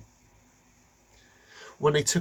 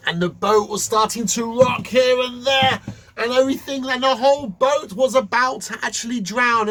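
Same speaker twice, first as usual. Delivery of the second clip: loud, high, urgent storytelling; dramatic storm narration; 9 semitones higher, 7 dB louder than usual. A narrator's reading. Narrating the boat rocking in the storm, loud and urgent. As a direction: build the panic of the storm.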